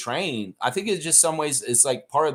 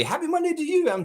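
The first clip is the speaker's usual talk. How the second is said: sing-song greeting. Pretend. Greeting chat on stream.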